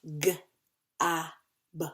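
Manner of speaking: "G-A-B" as separate letter sounds, guh, ah, buh; sounded out. Shown as a demonstration. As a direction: sound out "G-A-B" with a pause between each sound.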